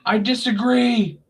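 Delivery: high, prissy mock objection; mocking Sami. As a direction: pipe up high like a whiny objector. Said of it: "And Sami was like".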